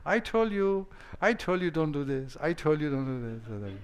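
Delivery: high, teasing I-told-you-so; gloating told-you-so. Retold or imitated. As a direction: rub it in with a grin. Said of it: "someone said".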